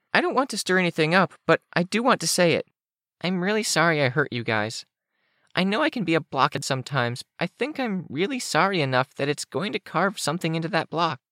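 put-on very high, childlike character voice; apologetic Kenzie.

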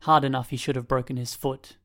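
ordinary delivery, nothing marked

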